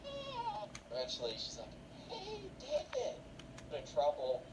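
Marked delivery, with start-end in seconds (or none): 0.0-0.7 very high, soft falsetto wail; mock crying. Scolded Ben begs for help, high mock crying. 2.1-3.2 high, drawn-out call; mom calling out. Acted. Mom calls to her son's friend, high drawn-out voice.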